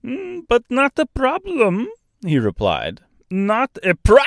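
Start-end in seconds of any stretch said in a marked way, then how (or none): 0.0-1.9 put-on kindly bird in Austrian-French accent. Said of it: "strangely thick, almost Austrian accent". 3.3-4.2 put-on kindly bird in Austrian-French accent. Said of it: "strangely thick, almost Austrian accent".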